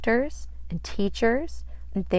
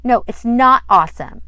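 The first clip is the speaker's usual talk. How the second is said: correcting; 4 semitones higher, 11 dB louder than usual. Acted. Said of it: "Sandy corrected".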